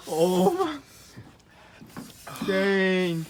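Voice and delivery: normal talking voice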